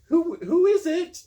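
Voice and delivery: high, slow, falsetto imitation of a young woman; faking Marion's voice. Disguised hero fooling the guard at the door, high falsetto. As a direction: squeak it out like a girl pretending. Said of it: "trying to sound like Marion".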